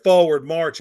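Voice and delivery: loud drill-style command; called marching command. Retold or imitated. Girl orders her squad out of the lake, loud command. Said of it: "She said".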